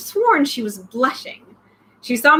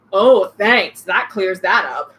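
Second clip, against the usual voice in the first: sarcastic; 4 semitones lower, 4 dB louder, slower than usual. Acted.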